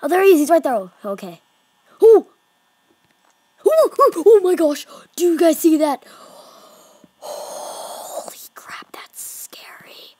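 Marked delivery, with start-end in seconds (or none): 0.0-0.7 high, loud alarm; panicked spotting. Real, not acted. Gamer yelling as the animatronic appears. 2.0-6.0 high, loud panicky squeal; frightened squeals. Real, not acted. Gamer squealing to viewers in fright. 7.2-10.2 slow, hushed whisper; awed scared whisper. Real, not acted. Gamer whispering slowly in awe and fear.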